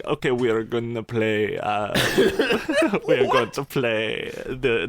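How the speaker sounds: a half-laughing try at a billionaire's strange voice; Elon Musk impression. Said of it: "I don't know how to do his voice".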